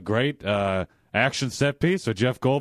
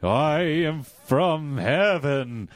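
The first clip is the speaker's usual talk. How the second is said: drawn-out solemn ghost voice; slow ghostly call; much slower than usual. Acted. Ghost head addressing Jeff, drawn out slowly. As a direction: stretch every word like a spirit. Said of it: "he's like".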